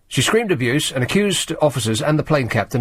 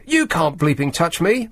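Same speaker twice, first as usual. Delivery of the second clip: mild mock scream; 7 semitones higher than usual. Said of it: "Campbell screamed".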